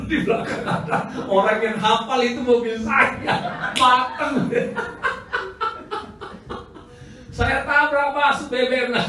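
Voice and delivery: laughing through the words, high; chuckling as he tells it; giggling at his own mishap. Real, not acted. Pastor laughing retelling caught.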